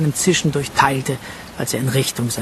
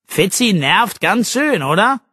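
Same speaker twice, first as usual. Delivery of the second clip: called out, slow; 5 semitones higher than usual. Acted. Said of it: "rief er Arabella zu".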